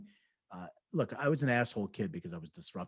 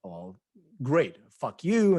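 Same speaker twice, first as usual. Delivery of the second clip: defiant outburst; 7 dB louder, slower than usual. Retold or imitated. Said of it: "I'm like".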